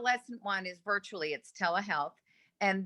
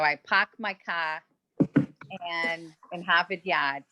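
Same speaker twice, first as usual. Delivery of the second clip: Boston accent, dropping the r's. Shown as a demonstration. Greeting colleagues as someone from there, Boston accent. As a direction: show off a Boston accent on the classic phrase. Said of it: "talk with my Boston accent".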